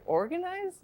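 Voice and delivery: very high incredulous question; acted-out bewilderment at an absurd claim; retold disbelief. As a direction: squeak it in bafflement. Baffled reaction to clerk's complaint.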